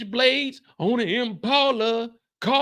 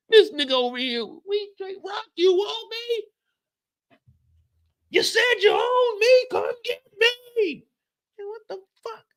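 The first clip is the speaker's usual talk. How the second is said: squeaky imitation of a street taunt; high mocking street taunt; 12 semitones higher than usual. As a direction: mimic the rowdy guy, pitched high.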